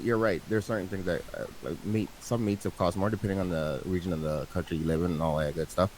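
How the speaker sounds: normal talking voice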